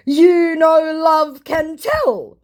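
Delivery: syrupy, manipulative scammer; mock scammer voice. Scammer trying emotional manipulation, slow mock voice. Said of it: "he tries, with a little bit of emotional manipulation".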